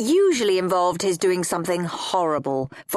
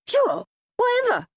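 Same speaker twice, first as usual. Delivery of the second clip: much higher, eager quick agreement. A narrator's reading. Henry eager for Gobble and Go, much higher voice. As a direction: agree in a rush, barely listening. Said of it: "said horrid Henry eagerly".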